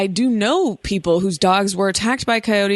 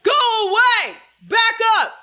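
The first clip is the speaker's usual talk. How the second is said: yelling at coyote. Retold or imitated. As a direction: shout it up high to drive it back. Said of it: "we yelled at it top volume".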